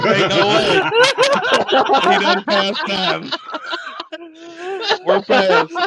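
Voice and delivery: laughing through the words; can barely get it out for laughing. Real, not acted. Laughing at the failed attempt.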